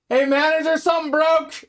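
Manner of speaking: high, loud yell across the office; shouted, high-pitched alert; calling out in alarm. Retold or imitated. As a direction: holler for the manager. Calling out to the manager.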